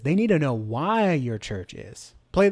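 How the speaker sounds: ordinary talk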